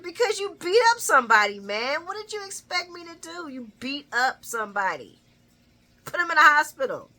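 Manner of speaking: high, loud mock exasperation; imitating an exasperated son telling Dad off. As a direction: re-enact a fed-up comeback, punching it out. Acts Dante's exasperated answer to Sonny.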